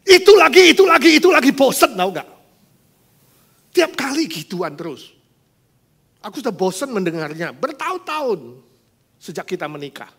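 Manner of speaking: high, loud exasperated rant; sick of hearing it, let it explode; husband fed up. Acted. Husband snapping at his wife's faith talk, high and loud. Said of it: "Suaminya langsung, pak, kestrom".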